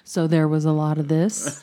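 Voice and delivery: very loud demo of noise; loud kid noise imitation. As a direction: blast it like a kid on a mic. Imitating loud kids on microphone.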